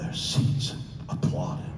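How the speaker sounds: ordinary talk, nothing marked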